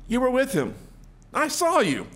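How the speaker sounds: high-pitched, pointed accusation; acted accuser, pitched well up; accusing servant girl. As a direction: call him out in a high voice.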